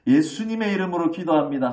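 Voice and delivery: loud, confident prayer, like brandishing an official badge; bold royal-envoy prayer. Shown as a demonstration. Believer praying boldly in Jesus' name, loud and sure. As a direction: pray as if flashing a royal inspector's badge. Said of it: "암행어사 출두에 하는 것처럼 예수님의 마패를 들고".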